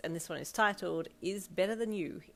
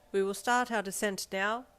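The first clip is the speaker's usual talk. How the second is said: higher, louder captain's announcement; captain over loudspeaker; 4 semitones higher, 6 dB louder than usual. A narrator's reading. Captain tells passengers of the descent, announcing. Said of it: "the voice of the captain said".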